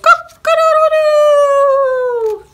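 loud, high, long-held cock-a-doodle-doo; rooster crow. A narrator's reading. Rooster waking the farmer at sunrise, crowing loudly. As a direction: crow at full volume like a rooster. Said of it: "the rooster crowed".

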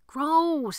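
high loud "Gross"; disgusted cry. Acted. Boy touched by corpse's tongue, disgusted cry. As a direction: recoil in disgust.

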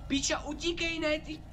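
high panicked cries; panicked yell. Real, not acted. Streamer tells himself to run, panicked cries. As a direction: shout it while fleeing. Running from the monster.

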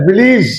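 ভিলিজ with long "i" in the last syllable, falling; new "-ij" ending. Shown as a demonstration. Teacher correcting the students, ভিলিজ with "-leez". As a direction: say ভিলিজ as "vi-leez", teeth on lip for v. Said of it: "কি হবে?".

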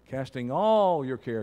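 emphatic, stretched "all"; stress on all. Shown as a demonstration. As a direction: lean long and hard on "all". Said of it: "Say it with emphasis".

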